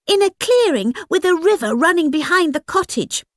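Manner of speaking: put-on fast, high girlish babble; excited chatter. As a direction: rattle it off like an eager child. Girl chatters away.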